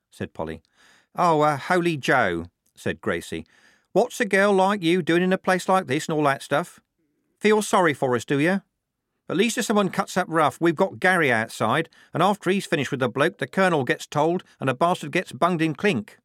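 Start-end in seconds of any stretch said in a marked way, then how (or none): 1.2-2.5 higher, slow character voice; Gracie. Acted. Gracie answering Polly's question, higher and drawn out. 3.9-16.2 put-on higher-pitched working-girl voice; chatty, high character voice; Gracie. Gracie explaining the house to Polly, high and chatty.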